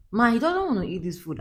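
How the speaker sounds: put-on child refusing food. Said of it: "he came and be like".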